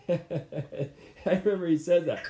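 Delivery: slow, laughing remark. Real, not acted. Recalling the coach's words: slow laughing aside. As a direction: laugh through it slowly.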